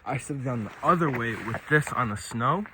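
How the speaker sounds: ordinary delivery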